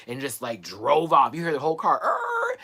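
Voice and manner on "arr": high squealed tire noise; car screech imitation. Telling how the driver sped away, high screech.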